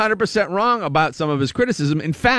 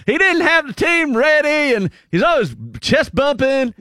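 mock Louisiana accent, pitched up in complaint; Terry Bradshaw; 8 semitones higher than usual. Host mimicking the pundit's gripe about the team, exaggerated Louisiana accent. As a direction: do a high, griping Louisiana football pundit. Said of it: "when Terry says it, in that Louisiana accent".

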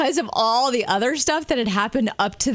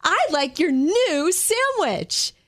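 high, slow, bubbly hello to the cashier; chirpy customer asking for the new sandwich; eager greeting; 6 semitones higher, much slower than usual. Retold or imitated. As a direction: sing it out like you can't wait to order. Wife bounds to the counter.

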